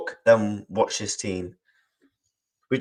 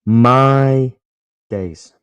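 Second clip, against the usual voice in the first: loud drawn-out my days; 8 dB louder than usual. Real, not acted. Streamer exclaiming "My days" to chat after a late goal, loud then sinking. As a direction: belt out "My" then sink on "days".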